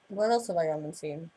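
normal talking voice

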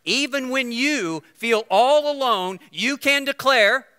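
loud, high, slow buildup; preaching build. Acted. Preacher rallying the congregation, loud and slow. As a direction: build toward the declaration.

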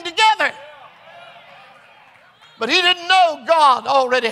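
driving preacher cadence rising to a shouted climax; fervent preaching. Real, not acted. Preaches at full force.

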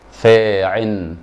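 "فاعل" drawn out slowly as "فاعٍ", ending in -in; lam dropped. Shown as a demonstration. Teacher shows students the pattern, lam dropped into nunation. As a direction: stretch "فاعل" into faa-'in, no final l. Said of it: "حذفنا اللام".

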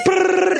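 imitated rolling noise. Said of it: "he would go like".

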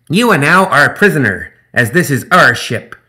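high, loud, grandiose gremlin speech; pompous gremlin captain. Acted. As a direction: declare terms like an honorable buccaneer. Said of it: "Zip said".

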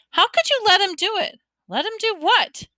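very high, incredulous exchange; upset outcry. A narrator's reading. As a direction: cry out in disbelief, pitch way up. Acted quarrel of son and mother.

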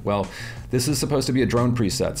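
usual speaking voice

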